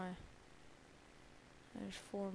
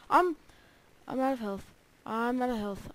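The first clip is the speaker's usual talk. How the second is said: alarmed; 3 semitones higher, 8 dB louder than usual. Real, not acted. Dying in hardcore.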